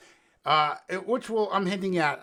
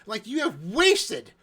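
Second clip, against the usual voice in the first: exasperated rant; 8 semitones higher, slower than usual. Real, not acted. Ranting about wasted virus plot.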